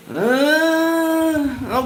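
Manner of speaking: high, long, held sceptical "uhhh"; doubtful drawn-out "uhh". Retold or imitated. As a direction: stretch a high hum of pure doubt. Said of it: "I give the same face all the time".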